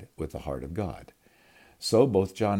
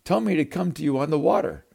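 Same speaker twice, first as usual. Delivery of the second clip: high, loud plea across the storm; shouting; 10 semitones higher, 7 dB louder than usual. Acted. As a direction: call it out over the waves. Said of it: "Peter shouted back".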